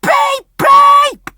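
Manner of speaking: high, loud imitated whistle; whistle blast. Driver warns with the whistle, loud high beeps. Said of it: "gave a warning blast on the whistle".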